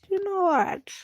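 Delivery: high, loud burst of joy; can't hold in the excitement; excited. Real, not acted. Overjoyed at viewer's gesture.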